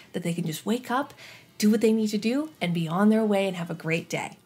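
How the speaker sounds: ordinary delivery, nothing marked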